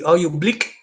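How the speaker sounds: put-on South African accent. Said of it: "Is that a South African accent".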